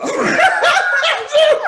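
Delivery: a big shouted laugh, high; loud laugh. Real, not acted. Laughing out loud at mama's reply. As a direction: burst out laughing at her answer.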